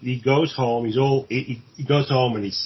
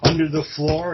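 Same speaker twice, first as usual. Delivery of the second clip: much higher, louder, fast mocking challenge; mocking taunt; 7 semitones higher, 4 dB louder than usual. Killer taunting the police during the search, mocking. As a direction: dare them to look, sneering.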